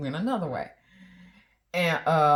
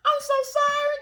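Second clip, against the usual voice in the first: much higher, louder, pleading; apologetic husband; 8 dB louder than usual. Retold or imitated. Wife imitates husband apologizing to her, much higher and louder. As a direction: grovel an apology. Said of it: "He was like".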